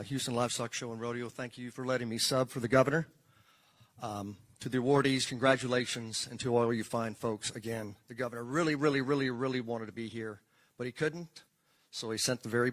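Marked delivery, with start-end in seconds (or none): none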